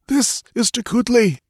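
high, tense voice of the guide; frightened guide Tekodl. A narrator's reading. Tekodl answers Conan without looking back, high and tense. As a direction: pitch up high for the nervous guide.

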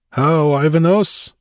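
high, slow challenge; challenging. A narrator's reading. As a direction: call the man out by name. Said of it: "he challenged".

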